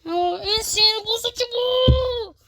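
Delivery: high, loud, slow exasperated complaint; frustrated outburst. Real, not acted. Gamer complaining to viewers about the level, exasperated and loud. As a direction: vent at the game, exasperated.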